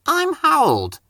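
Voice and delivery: Harold the helicopter. Acted. Helicopter introducing himself to Percy, high and proud. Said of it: "said the helicopter".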